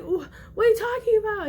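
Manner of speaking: high, stammered mock protest; retold tearful confusion. Child answering an angry mom, tearful and confused. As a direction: act out a bewildered, crying kid. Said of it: "And I started profusely crying".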